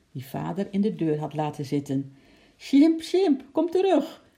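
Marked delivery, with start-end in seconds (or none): 2.6-4.2 high, loud, urgent cry; desperate call to come back. A narrator's reading. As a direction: shout after a runaway, pitched right up. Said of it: "riep Lotje".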